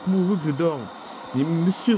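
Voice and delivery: ordinary talk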